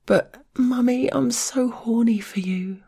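put-on breathy girl voice. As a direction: beg in a high breathy girl voice. Girl begs her Mummy.

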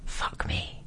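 barely audible whisper; mouthed whisper. A narrator's reading. Flirting silently at a stranger across the bar, whispered. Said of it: "and mouthing".

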